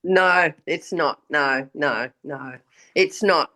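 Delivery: ordinary talk, nothing marked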